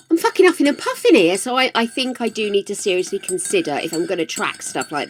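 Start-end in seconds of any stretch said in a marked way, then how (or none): 0.1-1.4 fast, higher, louder complaint; exasperated outburst. Retold or imitated. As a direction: blurt it out, fed up and fast. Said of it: "And I'm like".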